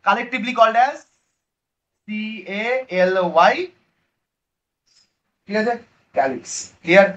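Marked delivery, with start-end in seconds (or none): 2.1-3.6 letter by letter, very slow; spelled out slowly. Shown as a demonstration. Teacher spelling a term for the class, slow spelling. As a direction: spell it out with space between the letters.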